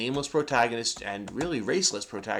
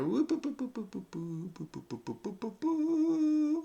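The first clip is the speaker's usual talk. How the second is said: boop noises; 10 semitones higher, 9 dB quieter than usual. Real, not acted. Nudging camera frame.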